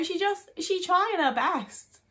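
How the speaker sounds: high, slow sympathetic coo; tender pity. Real, not acted. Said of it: "fond pity".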